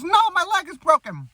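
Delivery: high, pained whine; whiny informant. Retold or imitated. Informant pleads with the spy, high pained whine. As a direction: play the hurt informant pleading.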